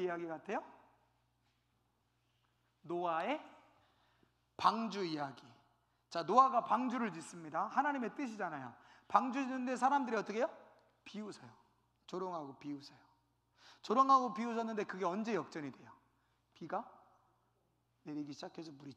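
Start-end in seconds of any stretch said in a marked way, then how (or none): none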